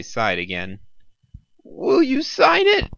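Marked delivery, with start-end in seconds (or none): 1.6-2.9 high, slow nervous squeak. A narrator's reading. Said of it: "she squeaked".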